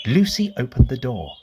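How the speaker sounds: ordinary delivery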